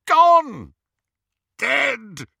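high, loud cries of grief; anguished shout. Acted. Grieving Snape to Dumbledore, loud anguished shout. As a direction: hurl each word out in pain.

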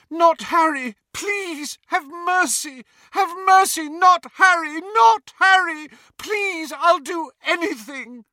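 high, loud, frantic begging; desperate pleading. Acted. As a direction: beg for your child's life. Mother begs for her son.

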